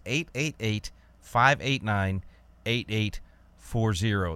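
slow, careful read of "eight eight eight"; slow number. Shown as a demonstration. Host repeating number slowly for listeners. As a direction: hold each digit for the callers. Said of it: "That's all I can do".

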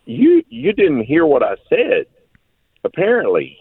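pitched well up in incredulous exasperation; mock disbelief, as if dad is not listening; exasperated. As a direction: son telling his father he didn't hear, exasperated and high. Retelling disbelief at dad's advice.

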